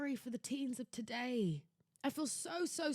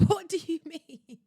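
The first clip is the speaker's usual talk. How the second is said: louder, higher, incredulous cry; what do you mean, higher and louder, half laughing; indignant outburst; 6 semitones higher, 5 dB louder than usual. Acted. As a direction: let the outrage burst out with a laugh.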